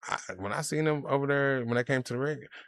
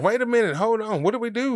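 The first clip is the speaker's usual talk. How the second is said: higher, louder imitation of alarmed farmers; mock alarm; 9 semitones higher, 5 dB louder than usual. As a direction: act out farmers suddenly caught off guard. Farmers' alarmed reaction, acted out.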